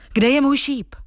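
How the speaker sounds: high-pitched, eager storybook question; impatient exclamation. A narrator's reading. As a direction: call it out, eager for an answer. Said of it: "zvolal nedočkavě". (nedočkavé zvolání)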